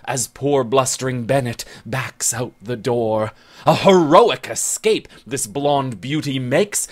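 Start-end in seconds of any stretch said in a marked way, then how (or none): none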